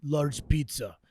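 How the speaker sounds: slow, heavy Russian accent, lightly imitated. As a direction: mimic a Russian lifter's thick accent, slowly. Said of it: "says in his Russian accent".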